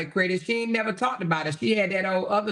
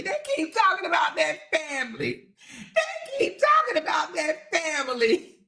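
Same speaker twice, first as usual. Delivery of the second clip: laughing shout; 10 semitones higher than usual. Shown as a demonstration. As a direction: belt it out while cracking up.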